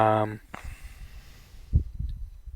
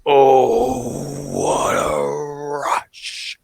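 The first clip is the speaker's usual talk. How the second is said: Hawk; 2 semitones higher, much slower than usual. Acted. Hawk answering Animal in classic fashion, drawn-out catchphrase. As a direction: stretch it into a long wrestler's growl. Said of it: "just in classic Hawk fashion".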